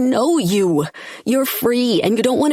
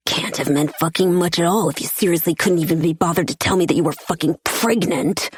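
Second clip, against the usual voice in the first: usual speaking voice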